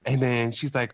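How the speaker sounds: ordinary talk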